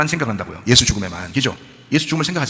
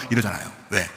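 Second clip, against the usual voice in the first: ordinary delivery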